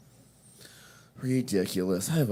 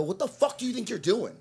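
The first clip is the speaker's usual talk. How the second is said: high-pitched retold outrage; infuriated challenge; 8 semitones higher than usual. Shopper confronting the yelling mother, retold infuriated and high. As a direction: act out confronting her, pitched up. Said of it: "I'm fucking infuriated".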